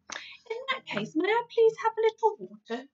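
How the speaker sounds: put-on high whispered little girl asking; shy girl's whispered request; timid Sophie. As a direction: ask meekly, hushed and high.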